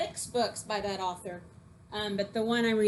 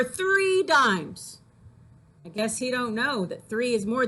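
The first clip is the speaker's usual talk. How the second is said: louder, lively read-aloud that builds up the jokes; poem reading; 2 semitones higher, 3 dB louder than usual. Acted. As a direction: tell it like a proud, clueless kid, punching the numbers. Teacher reads poem Smart.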